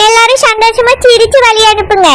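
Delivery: ordinary talk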